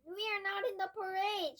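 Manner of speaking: loud, high call; shout. Acted. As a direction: shout it to the crowd. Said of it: "shouted Max".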